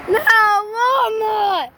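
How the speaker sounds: high, slow, stretched yell; yelling to a truck. Real, not acted. Kid yells after the Walmart truck, high and drawn out. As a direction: shout after the truck as it passes.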